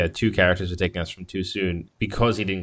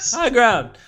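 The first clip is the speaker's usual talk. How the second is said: laughing quote; 13 semitones higher, 8 dB louder than usual. Real, not acted. Quoting Obi-Wan while laughing.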